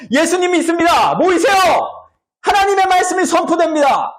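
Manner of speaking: high, projected preaching to a crowd; high-pitched, pretend-pious proclamation; show-off preacher. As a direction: proclaim it grandly, showing off your faith. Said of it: "되게 멋진 척".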